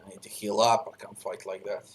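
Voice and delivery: ordinary delivery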